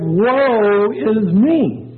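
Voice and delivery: high, drawn-out wail, very slow; dramatic cry of woe. Acted. Isaiah seeing the glorified Lord, wailing in woe. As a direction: cry it out long and high, in dread.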